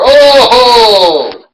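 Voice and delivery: high, loud and drawn-out outburst; shocked commentator shout. Acted. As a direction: yell it in total disbelief. Commentator reacting to a shocking moment.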